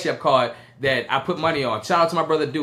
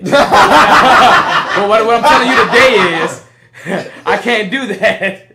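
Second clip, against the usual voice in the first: laughing loud through the words; 5 semitones higher, 13 dB louder, much slower than usual. Real, not acted. Telling a podcast story, laughing loudly. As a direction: crack up while saying it.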